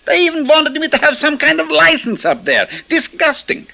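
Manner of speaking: indignant scorn. Acted. Indignant about a license.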